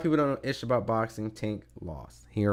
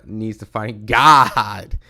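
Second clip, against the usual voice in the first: laughing; 11 dB louder, much slower than usual. Real, not acted. Laughing mid-sentence at haters.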